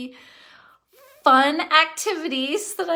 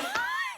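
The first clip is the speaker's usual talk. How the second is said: laughing; 6 dB quieter than usual. Real, not acted. Laughs at losing the word.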